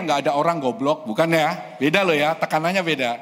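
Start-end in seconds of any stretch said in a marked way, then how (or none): 0.0-1.0 "goblok" ditekan keras, salah; wrong stress on goblok. Shown as a demonstration. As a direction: pukul kata "goblok" dengan keras. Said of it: "Bukan gini ya". (tekanan salah pada "goblok")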